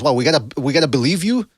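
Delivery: ordinary talk, nothing marked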